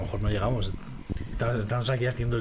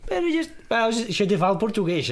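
much higher mock Portuguese accent; Portuguese accent, pitched much higher; 8 semitones higher than usual. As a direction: put on a playful Portuguese lilt. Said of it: "Ahí sí te hablo portugués".

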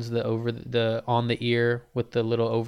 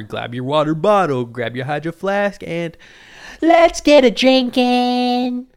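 louder, hyped sponsor pitch; ad read; 4 semitones higher, 8 dB louder than usual. Acted. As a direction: sell it like a cheesy radio ad.

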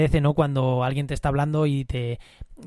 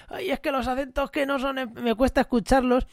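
whiny complainer; 9 semitones higher than usual. Retold or imitated. Podcaster mimics listeners who complain about accents, mock whiny. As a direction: imitate a fussy listener who dislikes accents. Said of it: "me han dicho".

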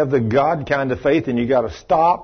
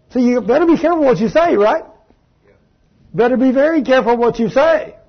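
stern warning; 9 semitones higher than usual. Real, not acted. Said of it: "warning congregation".

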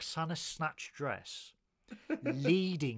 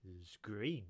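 low and much softer, "green" drawn out flat; a hushed lower deadpan "it's green"; deadpan reply; 7 semitones lower, 9 dB quieter, slower than usual. Retold or imitated. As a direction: mumble it like a drunk keeping a secret. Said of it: "And he goes".

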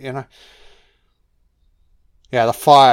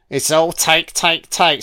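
ordinary delivery

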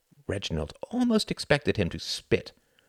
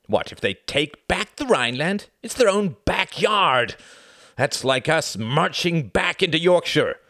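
enraged rant; 7 semitones higher, 4 dB louder than usual. A narrator's reading. Cuthbert enraged at the news.

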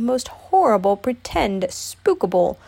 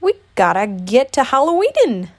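loud, higher, eager urging; Tigger; 4 semitones higher, 6 dB louder than usual. A narrator's reading. Tigger leads Piglet to the door, loudly eager. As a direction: push him along with bouncy excitement.

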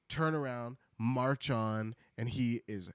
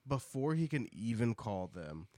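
usual speaking voice

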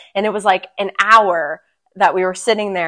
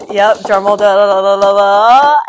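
loud sung da da drum roll; vocal drum roll; 8 dB louder than usual. Pretend. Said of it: "Big drum roll".

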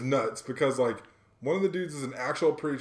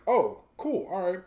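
higher, surprised little oh cool; mock surprise; 7 semitones higher, 5 dB louder, slower than usual. Telling friend how he reacted to the shop, surprised. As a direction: act pleasantly caught off guard.

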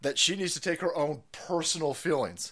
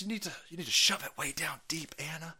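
whisper; 9 dB quieter than usual. Real, not acted. Aside to Anna.

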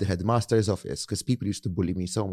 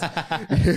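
a high burst of laughter; 9 semitones higher, 4 dB louder than usual. Real, not acted. [name] laughing at his own headmaster story, high and bright. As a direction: laugh at your own joke.

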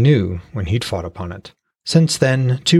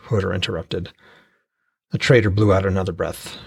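usual speaking voice

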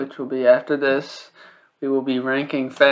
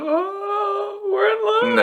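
squeaky high, swooning voice; mock falsetto; much slower than usual. Teasing cousin, mock love declaration.

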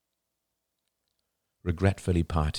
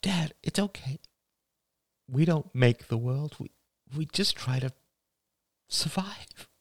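high, tender voice fading to a whisper; daughter character, consoling; 12 semitones higher than usual. Acted. Daughter reassuring her dad, tenderly. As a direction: comfort him haltingly, thinning into breath.

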